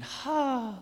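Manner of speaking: high, loud imitated child's sigh of relief; contented sigh. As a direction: sigh like a relieved little girl. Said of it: "she sighed deeply and went".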